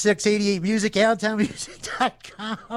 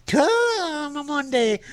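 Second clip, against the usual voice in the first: high, slow stretched promise; sing-song hype; 8 semitones higher, slower than usual. Pretend. DJ hyping a sponsor, high and slow. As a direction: drag it out like a pitchman. Ad phrase repeated.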